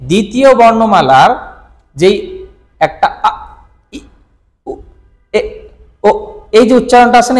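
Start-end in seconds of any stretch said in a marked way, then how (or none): 3.2-6.1 আ, ই, উ, এ, ও short, each on its own; isolated vowels. Shown as a demonstration. Teacher listing the second syllabary, each vowel separate. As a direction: pause after every vowel from আ to ও. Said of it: "এই উচ্চারণটা চলে যাবে".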